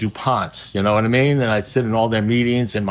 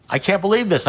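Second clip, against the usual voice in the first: disbelief; 9 semitones higher than usual. Retold or imitated. Astonished at talking dog.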